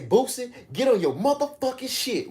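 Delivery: a mock stern mother telling him off; imitating his mama. Mama at sixteen telling [name] off, high scolding voice. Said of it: "She say, she say".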